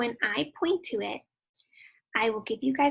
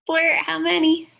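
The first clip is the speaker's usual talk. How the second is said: loud cue; 6 semitones higher, 10 dB louder than usual. Acted. Teacher cues kids to shout.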